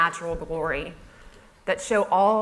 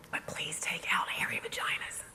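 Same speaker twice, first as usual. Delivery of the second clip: hushed, delicate whisper; whispered plea; 11 dB quieter than usual. Retold or imitated. Brand asking blogger to take out two words, whispered. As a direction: whisper it like an unmentionable request.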